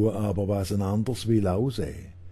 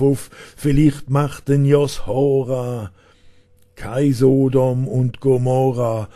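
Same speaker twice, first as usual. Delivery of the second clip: louder, higher declamation; rousing poem recital; 4 semitones higher, 7 dB louder than usual. Acted. Poet urging young people on in humorous dialect verse, rousing declamation. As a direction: drive the verse up to a loud, insistent peak.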